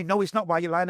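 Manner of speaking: high, accusing mock indignation; a schoolgirl refusing to believe him; insistent schoolgirl. Schoolgirl getting angry with him.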